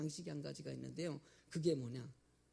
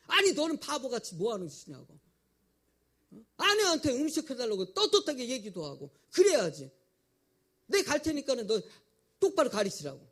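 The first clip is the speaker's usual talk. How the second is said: loud, higher exasperated telling-off; scolding mother; 5 semitones higher, 9 dB louder than usual. Retold or imitated. Mother scolds her son for not standing up to his wife, loud exasperated telling-off. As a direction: chew out the foolish son.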